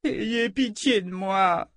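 high, slow tearful begging; sobbing plea. A narrator's reading. Gadi sobbing, begs Kalan for pity, high and slow. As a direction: beg through sobs. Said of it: "Il éclata en sanglots".

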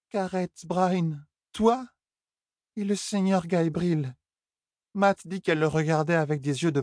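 high, slurred, wounded rant; bitter drunk Elaine. Acted. Elaine ranting to Tom about her mother's lovers, high and bitter. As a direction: pour out the hurt, tipsy.

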